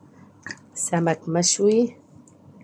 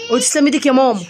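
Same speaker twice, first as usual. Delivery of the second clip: high, loud insisting call; insistent louder repeat; 8 semitones higher, 7 dB louder, faster than usual. Real, not acted. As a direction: push it louder the second time. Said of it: "repeats demand louder".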